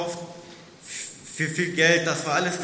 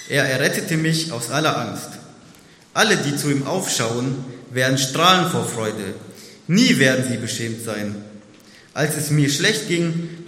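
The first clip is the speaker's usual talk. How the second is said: scripture reading; 5 dB louder than usual. Acted. Reads psalm aloud.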